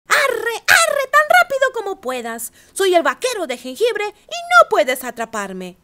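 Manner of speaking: high, loud taunting sing-song; teasing cookie voice. A narrator's reading. Gingerbread cowboy taunting the ranchers, high loud sing-song. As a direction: taunt them, high and cocky. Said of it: "se rió y dijo".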